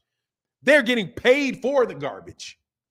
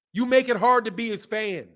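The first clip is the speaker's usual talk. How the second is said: normal talking voice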